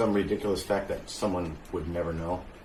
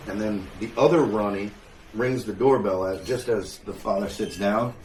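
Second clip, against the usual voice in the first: ordinary talk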